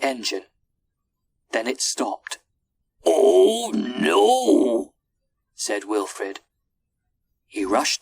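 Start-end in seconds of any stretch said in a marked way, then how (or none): 3.1-4.9 loud, high, drawn-out cry; loud alarmed exclamation; dismayed captain. A narrator's reading. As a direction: cry out as disaster strikes. Captain dismayed as engine stops.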